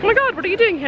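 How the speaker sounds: high-pitched imitation of an amazed fan; retold fan greeting, high and excited; excited American viewer. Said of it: "an amazing American viewer who was like".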